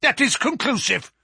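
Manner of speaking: high, indignant pronouncement; irate professor, pitch jumping up; angry. A narrator's reading. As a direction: declare it in triumphant anger. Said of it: "glaring angrily at my companion".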